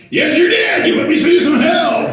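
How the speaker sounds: loud, high emphatic yes; emphatic dad. Retold or imitated. Father answering his grown daughter, loud laughing agreement. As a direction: shout it back with a laugh.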